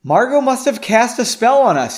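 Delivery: high, loud, hurried alarm; urgent reply. A narrator's reading. Urgent reply while fleeing.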